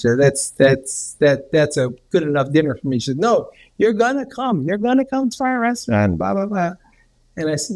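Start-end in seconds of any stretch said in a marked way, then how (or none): 3.2-6.7 higher-pitched mock insisting; imitated friendly insistence. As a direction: overrule a polite refusal, as the wife did. Imitating wife overriding his refusal.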